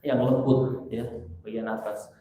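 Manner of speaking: ordinary talk, nothing marked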